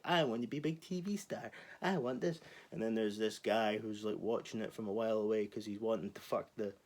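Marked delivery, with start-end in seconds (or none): 0.0-2.4 high, whiny wannabe-star voice; mock a fame-hungry teen; mocking teenager voice. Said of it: "random teenagers who are like".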